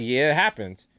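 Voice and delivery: low, offhand shrug of a reply; unbothered shop guy. Retold or imitated. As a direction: brush it off casually in a low voice. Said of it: "the guy was like".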